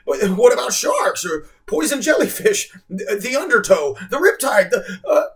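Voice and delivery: high, loud stammering panic; panicked stammer. A narrator's reading. Boy fearing sharks, high panicked stammer. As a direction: blurt out every danger.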